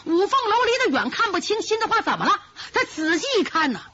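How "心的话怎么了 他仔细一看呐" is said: ordinary delivery, nothing marked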